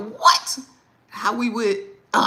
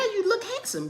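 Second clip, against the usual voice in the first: higher, sing-song praise for her son; high coaxing compliment. Real, not acted. Mother coaxing her son to respond, high cooing praise.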